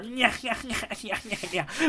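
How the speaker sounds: villain laugh. Retold or imitated. Mock villain laugh after quote.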